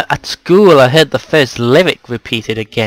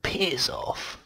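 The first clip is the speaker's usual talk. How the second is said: whispering; 4 semitones higher, 13 dB quieter than usual. Pretend. Whispered insult.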